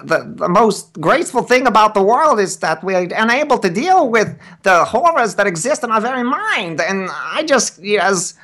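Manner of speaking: very high-pitched, reedy voice; Howard Phillips Lovecraft. Shown as a demonstration. Guest demonstrating full Lovecraft for the host, absurdly high and reedy. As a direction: pipe it out thin and reedy, far above normal. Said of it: "had a high-pitched, reedy voice".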